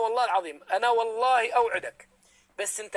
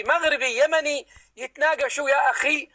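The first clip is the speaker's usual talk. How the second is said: usual speaking voice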